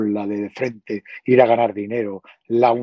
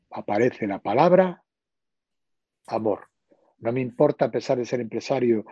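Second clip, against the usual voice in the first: normal talking voice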